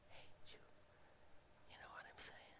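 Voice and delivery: voiceless whisper, barely audible; whisper demo. Shown as a demonstration. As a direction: whisper it as a demonstration. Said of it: "Now, whisper".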